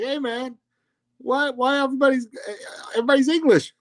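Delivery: high-pitched, exasperated questioning; mock bafflement. Fan griping about British actors playing Americans, baffled. Acting out own baffled reaction.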